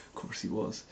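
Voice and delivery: dry whisper under her breath; whispered aside. Real, not acted. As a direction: mutter it as a wry aside in a whisper. Whispered aside about Ron.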